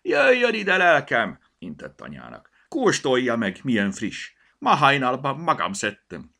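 high, loud stallholder's sales call; hearty, loud vendor's patter; market woman calling out. A narrator's reading. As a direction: hail a customer across the market. Market woman calls customer over.

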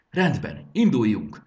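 sharp, loud go-ahead; rally the team to move out; commanding call. A narrator's reading. Jakab giving the order to leave, loud command. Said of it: "vezényelt Jakab".

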